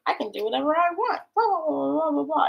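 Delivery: mock him as a cocky guy bragging; mocking arrogant partner. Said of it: "they're just gonna be like".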